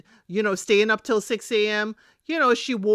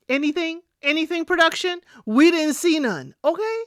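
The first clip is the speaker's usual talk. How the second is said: calling out production; 7 semitones higher than usual. Real, not acted.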